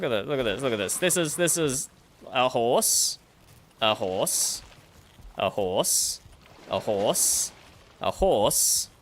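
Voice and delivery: each "a horse" listed with a rising lilt; sarcastic lecture; mock outrage. Real, not acted. Mock indignant listing of horses.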